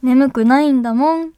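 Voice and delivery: cutesy act of insisting you're awake; cute denial. Pretend. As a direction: put on a coy, sulky sweetness. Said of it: "可愛らしく眠くないんだもんって否定する".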